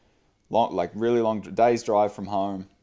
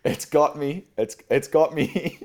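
mock scream, higher and drawn out; higher, slow mock cry of alarm; retold scream; 5 semitones higher, slower than usual. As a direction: imitate a friend screaming for help, half-laughing. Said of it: "And he's screaming".

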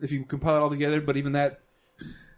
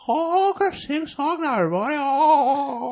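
high, slow goofy singsong voice; goofy manchild voice, drawn out; crazy voice; 11 semitones higher than usual. Retold or imitated. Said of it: "eighty percent of that is in a crazy voice".

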